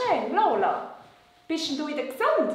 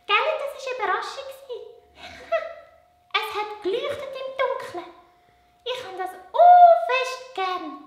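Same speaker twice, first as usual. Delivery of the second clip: much higher little puppet voice; 10 semitones higher, 6 dB louder than usual. Acted. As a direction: puppet girl, pitched way up. Puppet Lola giggly.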